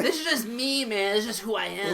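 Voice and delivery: high-pitched put-on effeminate protest; effeminate Puerto Rican man. Comic defending his stage voice, high effeminate protest. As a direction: plead it high in a mock effeminate voice. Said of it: "a rather effeminate Puerto Rican man".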